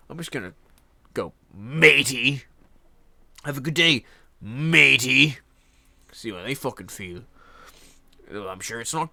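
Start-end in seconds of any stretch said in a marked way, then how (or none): 1.5-5.4 "matey" punched loudly each time; emphatic matey. Pretend. Copying the man to confuse somebody, "matey" stressed hard. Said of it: "if I wanna confuse somebody".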